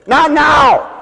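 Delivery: sharp mock shout from the wife; mock refusal. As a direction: snap it out, playfully annoyed.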